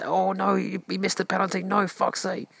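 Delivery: high mock groan of a letdown fan; imitating disappointed fan. Mocking men watching football miss, high disappointed. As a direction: play the fan moaning at a missed penalty. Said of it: "like, watching football".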